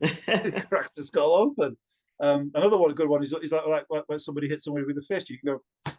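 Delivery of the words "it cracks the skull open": slow dramatic. Shown as a demonstration. Storyteller demos grisly line.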